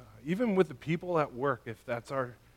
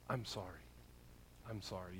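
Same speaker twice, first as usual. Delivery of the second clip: very soft, low, heartfelt apology; sincere apology; 8 semitones lower, 20 dB quieter, faster than usual. Acted. Parent apologizing to a child after blowing it, soft and sincere. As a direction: say it gently and mean it, voice dropped.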